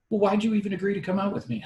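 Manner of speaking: high, fast retort as his date; imitated indignant challenge. Date challenges him after he refuses, high and fast.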